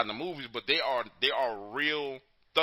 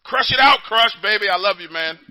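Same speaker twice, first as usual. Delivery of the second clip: loud, high, hyped goodbye; big cheerful shout-out; excited send-off; 9 semitones higher, 12 dB louder than usual. Real, not acted. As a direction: pump him up as he hangs up.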